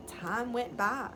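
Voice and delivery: ordinary delivery, nothing marked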